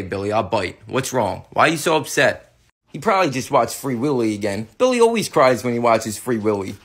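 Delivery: ordinary talk, nothing marked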